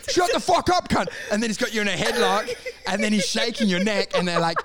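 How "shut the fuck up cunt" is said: high, forceful retold snap; pitched-up mock outburst; mock angry dad. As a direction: act out dad losing it for a laugh. Acting dad losing his temper.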